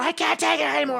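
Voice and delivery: high, desperate wail; freaking out again. Retold or imitated. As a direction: sob it out, high and pleading. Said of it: "I'm just freaking out".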